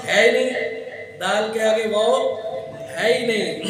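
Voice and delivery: loud, firm ہے ہی نہیں with ہی punched; teacher stressing the rule to pupils; emphatic insistence. Real, not acted. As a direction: insist there is none. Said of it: "teacher insisting".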